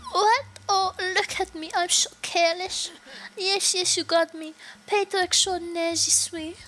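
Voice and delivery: put-on high, airy French accent; high-pitched fake French guest voice. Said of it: "she's supposed to be French".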